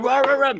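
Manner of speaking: short run of dog noises; imitated dog barking. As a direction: bark like an agitated dog. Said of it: "My dog, and".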